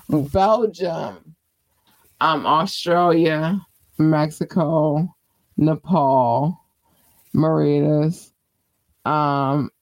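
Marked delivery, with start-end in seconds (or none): none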